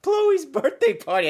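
very high, slow mock-Australian fairy welcome; bad Australian accent. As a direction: badly fake a squeaky Australian fairy. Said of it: "she had this Australian accent".

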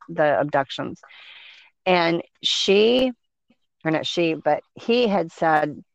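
ordinary talk, nothing marked

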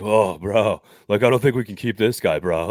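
lightly voiced meathead coach drawling bro; a hint of a jock coach talking roster cuts; Dan Campbell voice. Host teasing cohost with a light Dan Campbell voice. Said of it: "Can you do the Dan Campbell voice".

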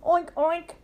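high spoken oinks; high-pitched storybook pig; pig oinking. A narrator's reading. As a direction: oink like a picture-book pig. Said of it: "says Pig".

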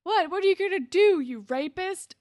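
loud, high mock-confrontational shout; retold yell. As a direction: yell it as an angry challenge, imitating him. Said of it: "yells at him".

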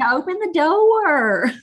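put-on high, slow Southern kid, "door" in two syllables; childhood Southern accent. Replaying her childhood video, slow high Southern accent. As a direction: drag out the Southern vowels like a child. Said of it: "talk about a Southern accent".

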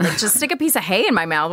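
ordinary talk, nothing marked

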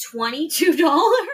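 high, loud disbelieving laugh; can't believe the price; shocked. Real, not acted.

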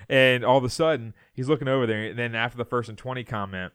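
usual speaking voice

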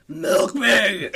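mock low, forceful demand; sudden low voice. Pig character turns on the visitors, suddenly low. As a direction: drop from squeaky into a low monster growl. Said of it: "all of a sudden he goes real low".

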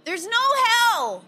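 high, loud yell across the street; shouted taunt. Retold or imitated. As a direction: shout it at the passing kids. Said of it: "I go".

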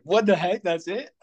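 retold mock outrage, pitched high; exasperated what-the-heck, imitated; incredulous. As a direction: replay the disbelief, up high. Retold reaction to friend's lame advice.

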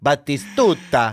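slow relishing בטיסטוטה, every syllable drawn out; savoring the name. Shown as a demonstration. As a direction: roll out בטיסטוטה slowly and lovingly. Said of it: "בטיסטוטה, איזה שם טוב זה".

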